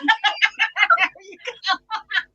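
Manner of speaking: loud, high burst of laughter; giggling out of control; laughing fit. Real, not acted. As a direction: crack up helplessly at the tongue twister. Cracking up at the tongue twister.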